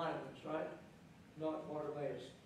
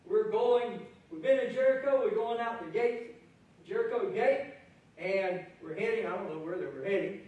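louder, higher, bustling excitement; excited crowd; 4 semitones higher, 6 dB louder than usual. Acted. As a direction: sweep along with the hurrying crowd. Preacher voicing eager crowd.

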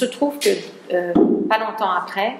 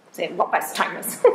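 laughing at herself; 2 semitones higher than usual. Real, not acted. Stumbling over an English word.

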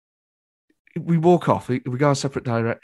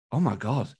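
soft, lower aside; quietly awestruck; 4 semitones lower, 8 dB quieter than usual. Retold or imitated. Hungover fan receiving a pep talk in the street, quietly awestruck. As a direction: murmur it to yourself, a touch awed.

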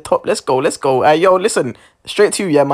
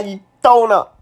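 ordinary talk

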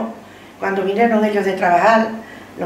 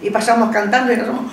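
normal talking voice

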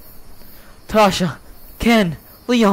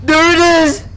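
frightened shouting; 8 semitones higher than usual. Real, not acted. Player chased by the llama, frightened shouting. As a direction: keep yelling in fright.

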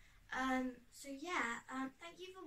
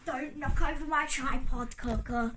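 loud scolding; 14 dB louder than usual. Real, not acted. Telling cat off loudly.

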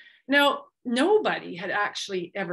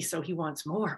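plain reading voice

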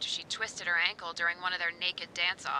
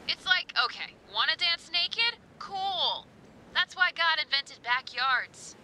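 sarcastic; 10 semitones higher, slower than usual. Acted. Mocking naked dancers.

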